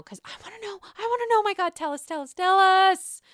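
high, building demand; pleading. Real, not acted. Begging show to reveal.

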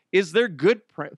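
ordinary talk, nothing marked

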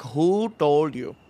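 slow, suspicious mock-mom question; pissed mom. Mom interrogates child about the sprinklers, mock pissed. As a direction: stretch "Who" a little, accusingly. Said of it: "she just lands at a sidewalk pissed".

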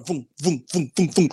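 rapid string of imitated booms; puck thud noises. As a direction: imitate pucks zipping past one after another. Imitates pucks flying by.

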